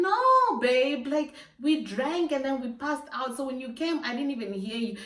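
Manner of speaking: high, loud protesting "no, babe"; hammed-up high-pitched excuse; pleading excuse. Pleading excuse to angry boyfriend.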